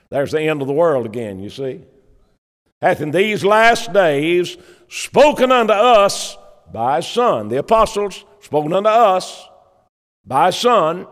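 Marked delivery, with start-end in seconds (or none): 2.8-7.5 each word laid down deliberately for the congregation. Acted. Preacher rereads the verse slowly.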